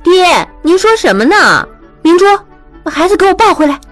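displeased. A narrator's reading. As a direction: take the babies back in a huff. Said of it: "玉汉一听不乐意了". (玉汉不乐意地对父亲抱怨)

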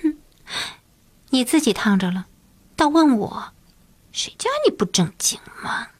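a hint of a coy girl, trailing into a whisper; coy scolding. A narrator's reading. Shy girl scolds the emperor coyly, half whispering. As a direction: tease him sweetly, then hush it. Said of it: "啐着说".

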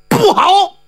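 sudden high-pitched cry of alarm. A narrator's reading. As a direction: cry out high as the hit lands. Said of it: "他大叫一声".

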